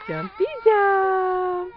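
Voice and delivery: high, slow, sing-song cheer. Real, not acted. Cheering a jumping toddler.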